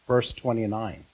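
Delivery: ordinary talk, nothing marked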